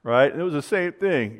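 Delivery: ordinary talk, nothing marked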